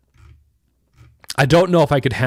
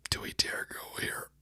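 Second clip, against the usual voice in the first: whispering; 16 dB quieter than usual. Real, not acted. Teasing before opening a clip.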